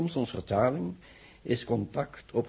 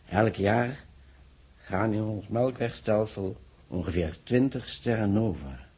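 ordinary delivery

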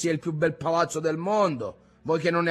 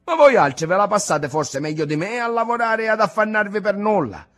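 loud, higher, bitter reply; angry retort; 8 semitones higher, 9 dB louder than usual. A narrator's reading. Ntoni lashing out at his grandfather, loud and bitter. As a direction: snap back at the old man.

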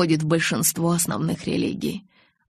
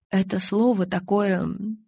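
usual speaking voice

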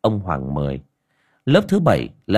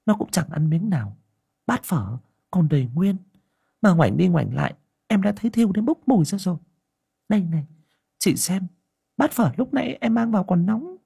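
higher, hushed village aunt voice, speeding up; a younger aunt confiding her fears, quicker as she goes; worried aunt; 9 semitones higher than usual. A narrator's reading. Aunt Liên telling Ngà about the child, worried and hushed.

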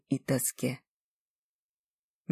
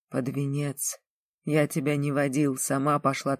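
plain reading voice

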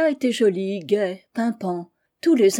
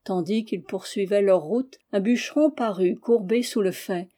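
ordinary delivery, nothing marked

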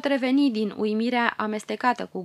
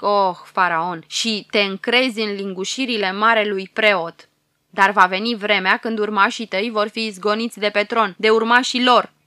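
loud, solemn prophecy of doom; fearsome prophet voice; 5 dB louder than usual. Acted. Old prophet warns the Pharaoh before the court, fearsome and loud. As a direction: thunder the warning like an old seer. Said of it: "mai adăugă profetul cu glas înfricoșător".

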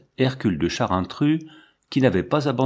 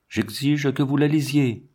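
firm, insistent demand, pitched higher; 4 semitones higher than usual. A narrator's reading. Husband insisting his wife read his letter, very firmly. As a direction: insist on it, leaving no room for argument. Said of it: "Paul parlait très fermement".

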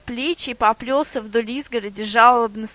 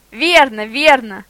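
eager; 7 dB louder, slower than usual. A narrator's reading. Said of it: "ответил волк".

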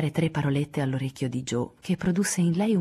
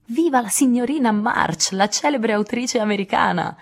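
loud, high cheer; jubilant shout; 8 semitones higher, 6 dB louder than usual. A narrator's reading. Laurie hails his writer friend in the open country, jubilant shout. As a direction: proclaim it like a toast to a celebrity. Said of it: "gridò Lori".

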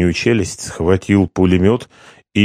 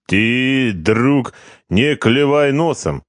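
put-on lightly Georgian-accented card player; a hint of a Georgian accent, teasing; 5 semitones higher, slower than usual. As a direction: chide him in a Georgian accent.

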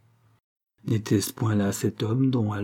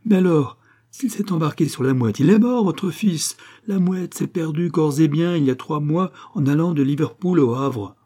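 higher, louder, gloating triumph; savage glee; 6 semitones higher, 4 dB louder than usual. A narrator's reading. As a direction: relish the bad news cruelly. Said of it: "une joie imprudente et sauvage". (Rossignol annonce la mort du fils avec une joie sauvage)

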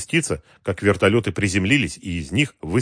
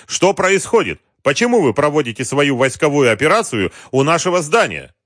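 raised, louder protest; indignant demand; 6 semitones higher, 5 dB louder than usual. A narrator's reading. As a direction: challenge the commander outraged. President confronts intruding commander. (возмущённый громкий протест, повыше)

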